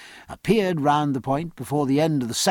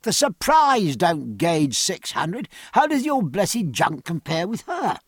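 raised, indignant captain's protest; crying out; 4 semitones higher than usual. Acted. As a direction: defend your own ship with feeling. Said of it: "cried Jack".

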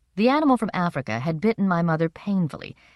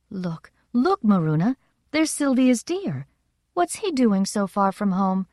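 excited; 5 semitones higher than usual. Acted. Spotting the deer, excited exclamation.

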